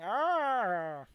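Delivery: high, drawn-out "arr"; unintimidated foe's drawn-out roar; defiant growling cry. Acted. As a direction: let a long creature cry swell and fall. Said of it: "He's like".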